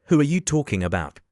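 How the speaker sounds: plain reading voice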